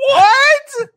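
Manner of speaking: loud shocked high cry; startled cry. Real, not acted. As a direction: yelp it out, startled. Sudden loud startled reaction.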